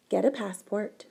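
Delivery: ordinary delivery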